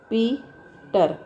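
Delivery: Peter in two slow beats, पी, then टर; split into syllables. Shown as a demonstration. Teacher showing the syllables in a word, Peter split in two. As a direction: break Peter after पी. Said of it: "अब इसको".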